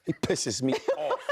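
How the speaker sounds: cracks up as he says it; giggling about his co-host; laughing. Real, not acted. Laughing at colleague.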